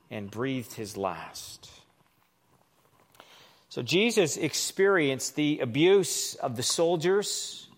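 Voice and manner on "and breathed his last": fading. A narrator's reading. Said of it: "slowing, fading reading of death".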